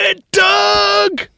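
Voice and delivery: shouted high and loud at the stage; hammed-up cheering yell; mock shout. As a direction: yell encouragement at a contestant. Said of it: "he goes".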